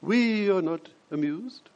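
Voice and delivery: slow, stately mock-queen voice; queenly royal we. Preacher mimicking the queen's we language, slow and regal. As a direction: draw it out like a haughty monarch. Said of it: "like the queen speaking in the we language".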